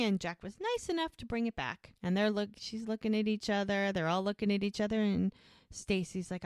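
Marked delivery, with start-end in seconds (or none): none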